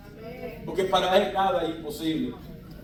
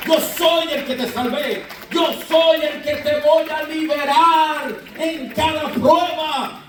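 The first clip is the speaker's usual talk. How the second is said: predicación enérgica; 7 semitones higher than usual. Acted. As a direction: preach it like a rallying cry. Predica con fuerza. (predicando con fuerza)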